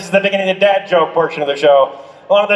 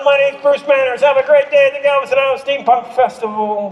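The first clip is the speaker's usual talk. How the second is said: higher, bright announcer thank-you; showman sign-off; 4 semitones higher than usual. Acted. Juggler thanking the festival crowd, announcer style. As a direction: ham it up like a stage host.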